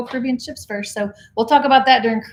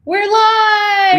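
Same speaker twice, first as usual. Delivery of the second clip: high, loud live announcement; excited opening call; 11 semitones higher, 8 dB louder than usual. Real, not acted. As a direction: burst in with energy. Opening a livestream, calling out.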